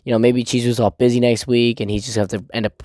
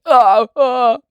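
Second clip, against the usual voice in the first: anxious fretting; 9 semitones higher than usual. Retold or imitated. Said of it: "And he's like".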